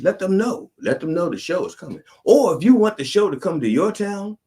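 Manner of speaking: high, loud hyped-up plug; excited pitch. Acted. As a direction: sell the show like a carnival barker.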